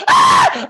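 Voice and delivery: loud burning scream; hammed-up scream. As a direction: let out a sudden pained yell.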